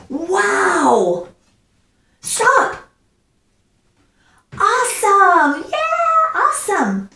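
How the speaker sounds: high-pitched, drawn-out wow and awesome; excited exclamations. Shown as a demonstration. Teacher showing the class exclamation points, excited exclamations. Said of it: "I have emotion about something".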